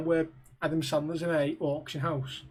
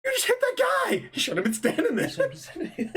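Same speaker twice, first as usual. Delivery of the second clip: higher, outraged mock shout; mock indignant yell. Friend quoting the golf movie, mock outraged yell. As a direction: squeal it like the indignant golfer. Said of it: "he's like".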